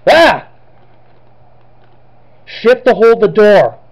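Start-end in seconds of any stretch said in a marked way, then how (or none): 0.1-0.5 sharp frightened yelp; startled scream. Real, not acted. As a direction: jump at the scare. Jump scare in horror game.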